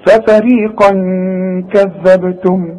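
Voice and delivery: ففريقا كذبتم with a hidden n hummed before k; slow chant, hold the nasal before كذبتم; ikhfa with ghunna. Shown as a demonstration. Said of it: "يبقى هخفي وغن". (إخفاء وغنة قبل «كذبتم»)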